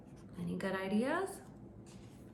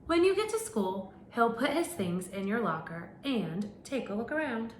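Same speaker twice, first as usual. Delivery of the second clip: animated picture-book narration; reading to children; 3 semitones higher than usual. Acted. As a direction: read it out to a class of little kids. Reads picture book to class.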